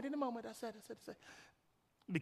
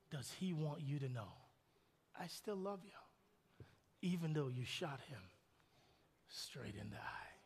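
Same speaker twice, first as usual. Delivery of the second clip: tender sermon close; 4 semitones lower, 8 dB quieter than usual. Acted. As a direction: draw them in gently. Quiet tender appeal.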